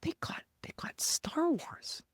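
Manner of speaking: breathy high whisper of delight; awed whisper. Retold or imitated. Viewer opening Disney Plus, whispering in awed excitement. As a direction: whisper it like a kid finding treasure.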